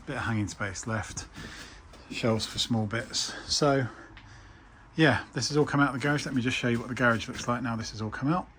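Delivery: ordinary delivery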